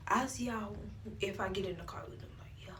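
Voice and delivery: breathy whisper aside. Real, not acted. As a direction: whisper it to the camera. Quiet aside to camera.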